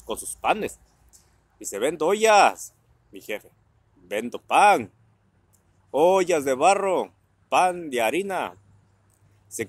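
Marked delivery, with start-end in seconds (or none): 1.8-2.7 loud drawn-out hawker's call; street vendor's cry. Retold or imitated. Pot seller crying his wares through the village, loud. 4.0-8.6 loud, slow drawn-out street cry; mock hawker's call. Dad copying the pot seller's cry to sell his bread, loud and slow.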